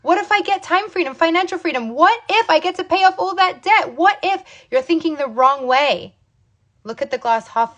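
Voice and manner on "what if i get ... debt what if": high-pitched, eager string of possibilities; rousing, higher-pitched pep talk; hopeful what-ifs. Acted. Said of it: "That's the wrong what ifs".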